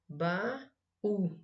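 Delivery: "Baú" split as ba-ú; syllable by syllable. Shown as a demonstration. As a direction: separate the a from the u in "Baú". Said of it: "separe-as em sílabas". ("Baú" separado em ba-ú)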